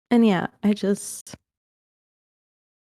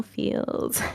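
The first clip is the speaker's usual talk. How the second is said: ordinary talk, nothing marked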